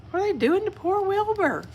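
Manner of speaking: high, soft, fast worried aside; gasped worry. Real, not acted. Reader worrying to children about poor Wilbur, soft and high.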